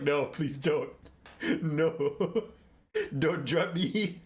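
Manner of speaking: whiny, laughing plea not to be dropped; mock pleading. Wizard begging the griffin mid-air, laughing mock panic. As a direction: beg the griffin half-laughing. Griffin trying to drop him.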